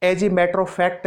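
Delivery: "as a matter of fact" spoken very slowly, word by word; slow careful demo. Shown as a demonstration. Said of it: "ऐसे ही". (धीमे-धीमे उच्चारण)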